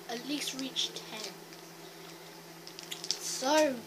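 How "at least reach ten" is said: whisper. Real, not acted. Whispered goal aside.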